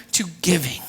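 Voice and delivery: hushed, breathless whisper. Real, not acted. Preacher whispering to the congregation about giving. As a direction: drop it to a whisper.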